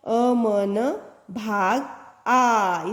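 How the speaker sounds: अ म न भाग read very slowly. Shown as a demonstration. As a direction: sound out each letter for the class. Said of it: "तो देखो". (अक्षर-अक्षर धीरे पढ़ना)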